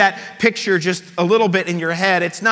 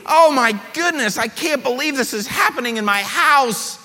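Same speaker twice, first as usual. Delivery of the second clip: disgusted Simon; 5 semitones higher than usual. Acted. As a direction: recoil in disgust as the Pharisee. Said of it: "Simon's thinking".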